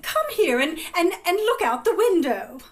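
fussy, high aunt voice; coaxing aunt. A narrator's reading. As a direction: coax a child away from mischief. Aunt distracting the misbehaving child.

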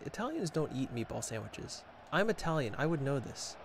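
normal talking voice

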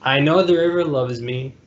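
ordinary delivery, nothing marked

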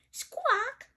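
high parrot squawk; high-pitched bird call; Polly. Acted. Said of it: "says Polly".

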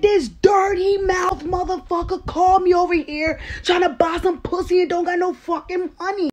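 high, strident accusing rant; furious yelling. Retold or imitated. Unpaid woman accusing him to newcomer, furious yelling.

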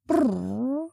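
high trilled purr; small high rolled murmur. A narrator's reading. Creature under a cape, murmured trill. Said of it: "a sound murmured from under his cape".

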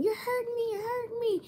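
very high-pitched imitation of a protesting patient; mock yelling. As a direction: cry out in a put-on high voice. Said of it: "She's, like, yelling at 'em".

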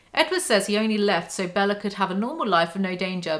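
ordinary talk

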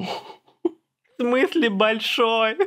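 high, very slow put-on question; mock disbelief. Streamer replaying the baffled question, mock disbelief. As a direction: drag out both words in a high voice.

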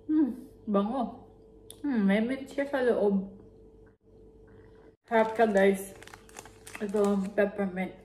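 chewing while talking; speaking between bites; mouth full of chocolate. Real, not acted. Seen 0: Bites chocolate at mouth, then chews with closed lips throughout.

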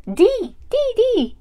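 high, eager doll voice; Peach. Pretend. Doll answering the teacher, high and eager. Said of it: "Okay, Peach, what's the next letter?".

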